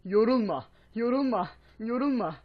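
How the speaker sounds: loud, high desperate pleading; frantic pleading. Real, not acted. Shouting at his tiring character to keep going, loud and high. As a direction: yell at the character not to tire out.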